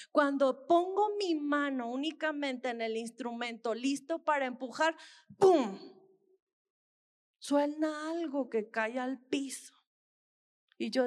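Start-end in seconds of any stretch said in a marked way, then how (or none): 5.4-5.8 loud sudden "pum" like a tooth dropping; loud mock thud; imitated bang. Retelling a tooth hitting floor.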